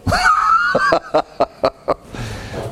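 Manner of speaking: high-pitched chuckling laugh; a real, long high laugh trailing off; hearty laugh. Real, not acted. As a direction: laugh heartily at your own joke. Amused by his own joke.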